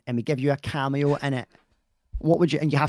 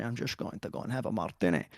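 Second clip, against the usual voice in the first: slightly lower, soft Connery-style Scottish burr; Sean Connery accent; 5 semitones lower, 8 dB quieter than usual. Acted. Husband mocking Connery's accent to his wife, mock Connery accent. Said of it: "have that sort of accent where they say".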